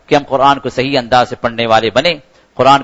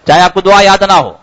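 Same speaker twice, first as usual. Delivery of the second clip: ordinary talk, nothing marked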